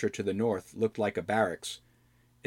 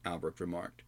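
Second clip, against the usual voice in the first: usual speaking voice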